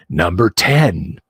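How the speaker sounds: low, slow announcer boom; fighting game announcer. Shown as a demonstration. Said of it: "Get my best announcer voice going on".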